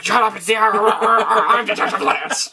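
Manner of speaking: hammed-up high-pitched rant breaking into laughter; mock freak-out. Detective insisting it's the Arrow, mock freak-out. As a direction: imitate a ranting detective, garbled and comic. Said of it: "like, freaking out".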